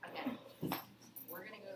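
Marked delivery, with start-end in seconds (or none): none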